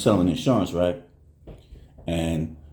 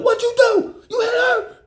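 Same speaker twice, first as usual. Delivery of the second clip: much higher, mock shouted accusation; accusing shout. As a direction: demand an answer from your daughter. Dad blaming daughter, shouting.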